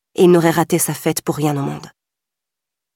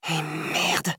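softer murmured curse; 7 dB quieter than usual. A narrator's reading. Wilson murmuring at the smell of decay. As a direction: breathe the curse out in dread. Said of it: "murmura-t-il à nouveau".

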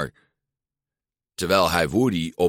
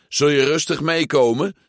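higher, louder mock sheriff order; 7 semitones higher, 4 dB louder than usual. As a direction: play the toy lawman demanding surrender. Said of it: "zei Woody".

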